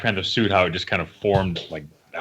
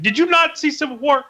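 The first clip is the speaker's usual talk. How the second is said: high, loud incredulous scolding; mock-exasperated fan; 3 dB louder than usual. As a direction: scold them in disbelief. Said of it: "And I was like".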